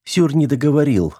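ordinary delivery, nothing marked

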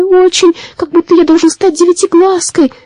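moaning. A narrator's reading. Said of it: "простонал маленький водяной".

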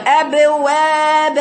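أبواب drawn out very slowly with throat and mouth open; ابواب chanted slowly with fully opened fatha; open-throat articulation. Shown as a demonstration. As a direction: hold each open vowel in ابواب long and level. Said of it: "افتحي معاكي، افتح حلقك معايا".

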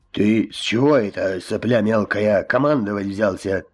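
lightly hoarse menacing voice; hoarse wheeze. Acted. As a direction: rasp it out like a brute. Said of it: "прохрипел Бурей". (прохрипел угрожающе)

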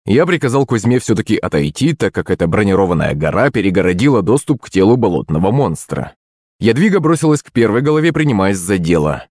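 ordinary delivery, nothing marked

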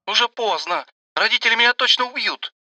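put-on high, begging young girl's voice; pleading girl. A narrator's reading. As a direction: plead like a scared teenager. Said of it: "взмолилась она".

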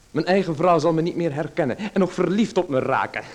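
giggling at the idea; laughing. Acted. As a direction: joke and laugh as you say it. Laughing at his own joke.

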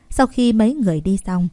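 ordinary delivery, nothing marked

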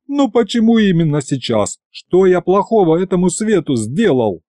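high, aggrieved lament; wailing complaint. A narrator's reading. Narrator bewails being called out just now, high aggrieved wail. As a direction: whine plaintively at fate. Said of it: "взвыл я".

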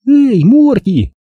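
high, loud happy call to the dog; excited greeting. A narrator's reading. Boy greeting his spaniel, bright excited call.

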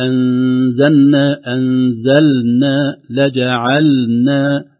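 أنزلنا and لجعلنا slowly, the lam sounded before the nun; clear lam. Shown as a demonstration. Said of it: "نقول".

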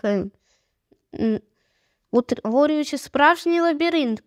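usual speaking voice

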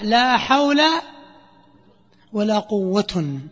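"لا حول ولا قوة" with the raf' endings, slow and deliberate; nominative case endings. Shown as a demonstration. Teacher voicing the raf' reading for students. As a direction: spell out the case endings for the class. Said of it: "ولك الرفع".

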